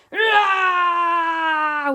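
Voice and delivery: long, high, loud monster roar; mosasaurus roar. A narrator's reading. Mosasaurus lunges from the waves, roaring. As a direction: roar like an attacking sea monster. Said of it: "It was a mosasaurus soaring".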